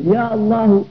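"يا الله" with a glottal stop before "الله"; hamza pronounced. Shown as a demonstration. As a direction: keep "يا" apart from "الله", sounding the hamza. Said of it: "بقطع الهمزة".

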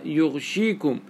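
يغشيكم said yugh-shee-kum, single sh; unvowelled ghayn. Shown as a demonstration. Teacher quoting Medina readers, يغشيكم as yughshikum. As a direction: stop on gh, no vowel after it, in يغشيكم. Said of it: "بضم الياء وسكون الغين".

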